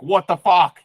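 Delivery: loud, high mock scream at the sky; screaming to the universe. Fed-up person yelling at the universe, loud mock scream. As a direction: yell it up at the universe in frustration. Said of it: "scream to the universe".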